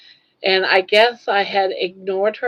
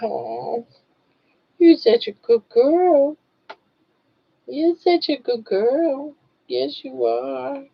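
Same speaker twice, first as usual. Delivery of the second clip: talking to a dog; 6 semitones higher, slower than usual. Real, not acted. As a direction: coo it sweetly to the dog. Cooing to her dog.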